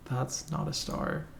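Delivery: quiet resignation over a failed star; deflated mutter. Real, not acted. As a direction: let it sink in, hushed. Repeats, deflated by drawing.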